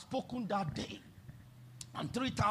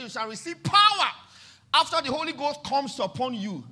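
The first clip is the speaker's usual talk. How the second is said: proclaiming scripture; 5 semitones higher, 7 dB louder than usual. Acted. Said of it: "he said".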